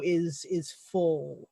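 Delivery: ordinary delivery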